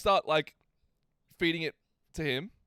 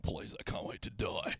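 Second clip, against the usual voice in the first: low, hushed worn-out toy voice; Dirty Mo; 7 dB quieter than usual. Acted. Filthy toy begging for death under a cuddling kid, soft croak. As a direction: plead softly like a toy that has suffered too much. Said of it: "Dirty Mo's just fucking lying there".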